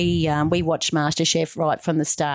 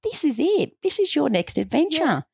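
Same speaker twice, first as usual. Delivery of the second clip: high, thrilled pep talk to herself; rally yourself, it's finally the day; excited self-talk; 8 semitones higher than usual. Retold or imitated. [name] on her birthday morning, telling herself this is it, thrilled and high. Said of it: "I said to myself".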